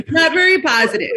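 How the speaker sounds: laughing through the words; giggling while speaking. Real, not acted.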